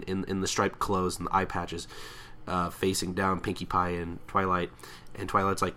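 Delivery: normal talking voice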